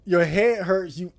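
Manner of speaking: ordinary delivery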